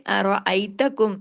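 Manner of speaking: अरअयतकुम slow, heavy रा; slow recitation. Shown as a demonstration. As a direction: give the रा weight. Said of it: "रा को heavy करें".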